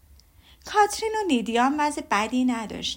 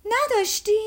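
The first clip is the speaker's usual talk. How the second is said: much higher, incredulous; surprised; 10 semitones higher, 4 dB louder, slower than usual. Acted. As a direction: toss back her word in disbelief. Elizabeth surprised at Jane.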